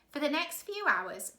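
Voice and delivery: usual speaking voice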